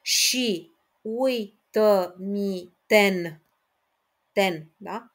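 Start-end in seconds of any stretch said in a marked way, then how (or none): 0.0-3.4 very slow, tapping out each syllable; split into syllables. Shown as a demonstration. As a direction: spell the line out syllable by syllable. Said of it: "Merg mai departe".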